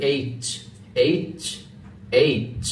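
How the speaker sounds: "এইচ" firm, ending in ch; emphatic H. Shown as a demonstration. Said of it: "এভাবে উচ্চারণ করতে হবে". (জোর দিয়ে "এইচ")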